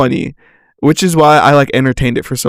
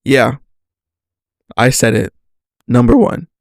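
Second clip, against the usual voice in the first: normal talking voice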